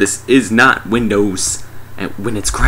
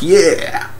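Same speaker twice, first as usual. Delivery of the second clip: higher, drawn-out celebratory yeah; mock cheer; 7 semitones higher than usual. Group has shot the alien, mock cheer. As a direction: cheer it in comic triumph. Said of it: "they're all like".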